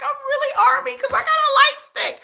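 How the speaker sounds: high, giddy squeal on one held pitch; hammed-up high-pitched glee; retold fangirl excitement. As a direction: gush it like a new fan. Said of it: "Like, I'm like".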